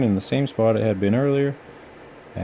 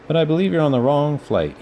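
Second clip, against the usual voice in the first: grinning; 6 semitones higher, 3 dB louder than usual. A narrator's reading. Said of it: "he grinned".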